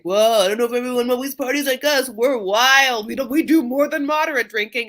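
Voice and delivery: loud, high hammed-up boasting; mock party bragging. Host mocks friends boasting about drinking, loud hammed-up bragging. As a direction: brag loudly, imitating a would-be party animal.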